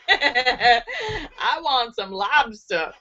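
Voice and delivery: slow words through laughter; laughing. Real, not acted. Host laughing at the lobster punchline, slow. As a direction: giggle the line out.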